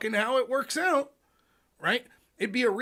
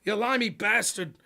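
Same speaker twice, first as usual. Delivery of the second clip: bad Australian accent; 9 dB louder than usual. Foreign tipster offering dirt, hammed-up Australian accent. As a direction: drag it out in a terrible Australian accent. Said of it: "I'm fucking terrible with accents".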